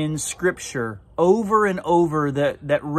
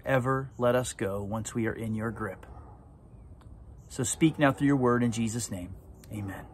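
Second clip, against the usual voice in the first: earnest prayer; 3 dB quieter than usual. Real, not acted. Pastor praying over the congregation, earnest and reverent. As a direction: pray it out with reverence. Pastor prays before the sermon.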